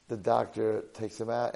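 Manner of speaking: usual speaking voice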